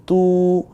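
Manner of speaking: তু held long and high. Shown as a demonstration. Quran teacher showing students how to lengthen তু. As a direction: stretch তু. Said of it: "আমরা বলবো".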